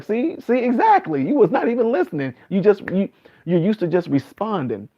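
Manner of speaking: high, animated gotcha, see I told you; excited gotcha. Retold or imitated. Mocking a stranger who wasn't listening, high and animated. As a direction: catch them out, pitch jumping high. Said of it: "I'm like".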